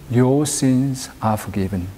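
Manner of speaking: normal talking voice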